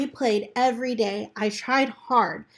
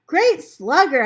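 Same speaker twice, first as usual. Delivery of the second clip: louder, higher cheer; yell; 6 semitones higher, 8 dB louder than usual. Acted. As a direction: cheer him on across the field. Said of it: "I yelled".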